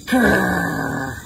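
low, drawn-out doggy growl; deep playful grr. Shown as a demonstration. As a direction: growl like a dog for toddlers. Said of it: "Can you growl like a dog?".

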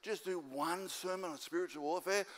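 small, soft begging voice; mock pleading. Congregant begging Pastor Shane for one sermon, meekly pleading. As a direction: imitate a meek, halting request.

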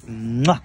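smack a kiss on the sore spot; kissing it better. Real, not acted. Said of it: "Shall I kiss it better?".

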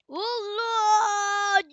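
high, loud cry of alarm; real panicked shout; startled shout. Real, not acted. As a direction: yell as you leap. Jumping into danger in game.

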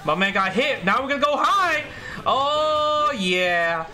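high, drawn-out gleeful call; hyped. Real, not acted. Gamer landing his mix-up on the opponent, hyped. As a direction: ride high on the momentum.

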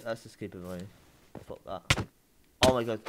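normal talking voice

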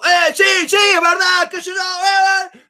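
high, loud, agitated rush of agreement; fired-up, shouting along at the top of the voice; high energy. Shown as a demonstration. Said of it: "lleva alto".

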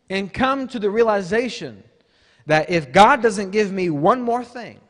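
loud, emphatic exhortation; forceful preaching. Real, not acted. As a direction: drive each phrase out to the whole congregation.

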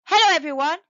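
loud bright hello; cheerful greeting. A narrator's reading. Prince Cosmos greets the robots on landing, cheerful loud hello. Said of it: "he said cheerfully".

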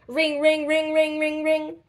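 high sung ring ring; phone ring. A narrator's reading. Narrator mimics the video phone ringing.

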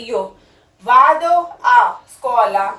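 each Italian syllable drawn out very slowly; slow model sentence. Shown as a demonstration. Teacher slowly models an Italian sentence for Punjabi learners. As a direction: spell it out slowly for the class. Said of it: "ਉੱਥੇ ਕੀ ਕਹਿਣਾ?".

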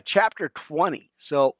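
ordinary talk

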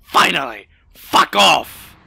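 yelled, exasperated; loud relieved shout. Real, not acted. Gamer shouting as the slow train finally arrives.

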